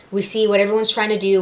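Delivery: normal talking voice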